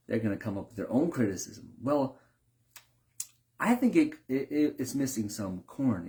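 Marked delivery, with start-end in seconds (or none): 1.8-6.1 hesitant critique. Retold or imitated. Guest inventing criticism.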